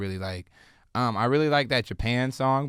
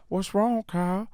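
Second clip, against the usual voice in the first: imitated friend, pitched way up; 8 semitones higher than usual. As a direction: ask it in a small, raised put-on voice. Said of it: "then [name]'s like".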